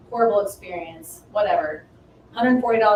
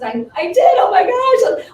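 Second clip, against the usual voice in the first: high, loud thrilled outburst; excited; 12 semitones higher, 11 dB louder than usual. Retold or imitated. Telling client about the new passport, excited. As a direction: reenact giddy excitement, pitch shooting up. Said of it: "I was so excited".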